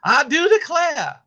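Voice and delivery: loud, high, slow Southern drawl; hammed-up Southern exclamation; Southern accent. Shown as a demonstration. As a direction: belt it out in broad Southern. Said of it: "say it in Southern".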